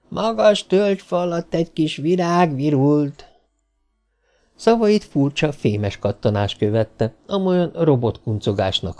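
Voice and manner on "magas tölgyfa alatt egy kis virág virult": high, giddy robot voice; giddy drunk robot. Acted. The malfunctioning robot taunts the men, giddy and high. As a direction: play a tipsy robot playing tag. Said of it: "feléjük kiáltott".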